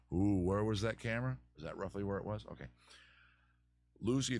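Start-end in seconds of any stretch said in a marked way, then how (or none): none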